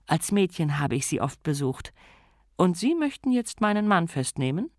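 Lady Sybil voice. Acted. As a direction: talk down to the young constable.

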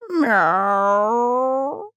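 high rising meow; cat meow. A narrator's reading. The cat Grebo meows at the coachmen, high and rising.

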